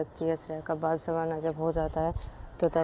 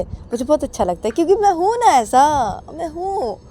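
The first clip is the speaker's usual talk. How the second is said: high, loud playful boasting; husband teasing his wife, high and playful; 6 semitones higher, 7 dB louder than usual. Acted. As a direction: gleefully own the insults.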